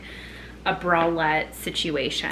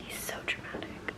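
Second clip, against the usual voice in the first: whispering; 16 dB quieter than usual. Real, not acted. Aside about the dog.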